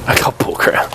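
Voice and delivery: quiet unvoiced complaint; whispered grumble. Real, not acted. Player grumbling about his loot, quiet whisper. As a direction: whisper it, disappointed.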